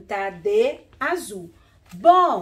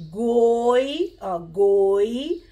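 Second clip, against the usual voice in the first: "goiaba" slowly as goi... a... ba; syllable by syllable; 5 dB louder, much slower than usual. Shown as a demonstration. Teacher building the fruit's name for pupils, slow and separated. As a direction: hold "goi-", then draw out each syllable of "goiaba". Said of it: "nós temos três".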